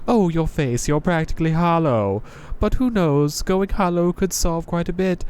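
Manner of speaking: a jaded knight greeting a newcomer; Crestfallen Knight voice. Acted. Knight teases player.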